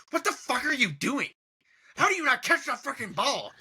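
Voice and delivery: mock outraged, high-pitched scolding; acted-out indignant tirade; imitating an angry player. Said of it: "He was so mad".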